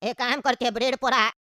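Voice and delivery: put-on low, easygoing old uncle. Uncle plots aside.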